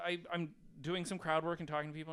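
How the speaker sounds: ordinary delivery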